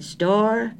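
"Store?" drawn upward in pitch, wary; suspicious rising inflection. A narrator's reading. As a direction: answer "Store?" with a high, questioning rise. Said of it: "rising inflection that seemed to indicate suspicion".

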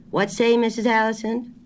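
high-pitched "didn't catch that" question; hard-of-hearing phone query. A narrator's reading. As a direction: call back down a poor line. Grocer can't hear on phone.

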